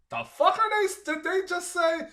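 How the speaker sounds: put-on American viewer lost in thick Irish accents, baffled; baffled disbelief. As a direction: pitch way up like you can't believe your ears. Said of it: "I went".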